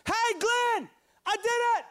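high excited shout across the room. Retold or imitated. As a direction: call out triumphantly through the window. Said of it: "and he shouts".

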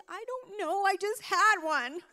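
hammed-up wail, high-pitched; 假装大哭. Retold overwhelmed wail to husband.

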